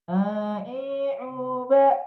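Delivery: "A, i, u, ba" pushed through the nose; blocked-nose, nasal syllables. Shown as a demonstration. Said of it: "yang ngirung atau lewat jarum hidung".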